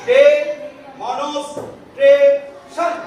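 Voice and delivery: "ডে, মনস, tray, শন" in separate pieces, a pause after each; syllable by syllable. Shown as a demonstration. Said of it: "প্রথমে আমি ভাগ ভাগ করে বলবো". ("ডে, মনস, tray, শন" ভাগ ভাগ করে, থেমে থেমে)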